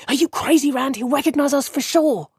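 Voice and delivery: high, panicky hushed protest; urgent, frightened warning; alarmed. A narrator's reading. Matt frightened, grabs Rand's arm.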